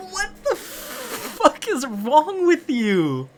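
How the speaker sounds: high, slow squeal of outrage; mock outrage. Boy answering her prom text, high-pitched mock outrage. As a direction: stretch it out in laughing, exasperated disbelief.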